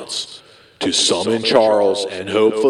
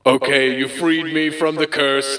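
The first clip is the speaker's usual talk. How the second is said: ordinary talk, nothing marked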